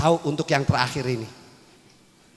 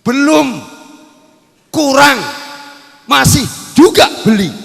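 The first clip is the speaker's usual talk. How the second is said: loud high exclaim of not enough; mock loud exclaim; 7 semitones higher, 7 dB louder than usual. Preacher teasing the wives, loud mock exclaim. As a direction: call it out, teasing. Preacher calls out, mocking wife.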